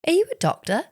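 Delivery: high curious driver question; lightly voiced cab driver asking; quoted taxi driver. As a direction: ask it up high like the cabbie.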